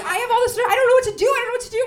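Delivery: high, loud frantic helplessness. Retold or imitated. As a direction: spiral out in panic. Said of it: "I was like".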